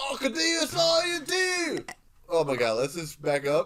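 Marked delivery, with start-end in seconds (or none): 0.1-1.8 much higher, louder, shouty mock Australian accent; a bragging actor yelling in a hammed-up Australian accent; over-the-top fake Australian accent. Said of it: "That's Australian".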